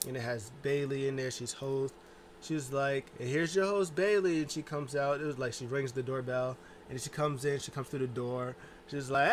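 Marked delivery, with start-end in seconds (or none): 3.2-4.4 announcer voice. Retold or imitated. Imitating a show announcer.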